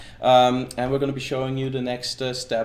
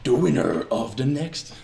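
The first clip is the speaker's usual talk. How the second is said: movie preview guy. Pretend. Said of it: "do my movie preview guy".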